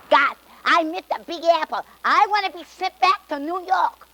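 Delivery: New York guy. Acted. New York guy's wish in joke.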